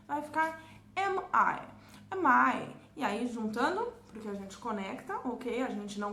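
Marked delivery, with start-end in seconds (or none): 1.0-2.7 "M I" com M fechado antes do I; "M I" pausado, M concluído; separated M I. Shown as a demonstration. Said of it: "precisa fechar a pronúncia pra esse som". ("M I" com lábios fechando)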